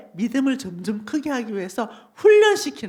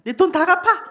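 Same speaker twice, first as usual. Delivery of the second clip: loud, higher acted command; angry king; 5 semitones higher, 6 dB louder than usual. King orders the jailed servant to repay, angry command. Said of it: "왕이 화가 났어요".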